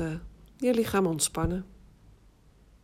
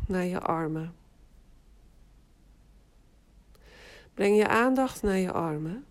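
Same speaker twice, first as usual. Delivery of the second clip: guided meditation. Acted. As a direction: guide them into rest, unhurried. Leads autogenic relaxation exercise. (rustige meditatiestem)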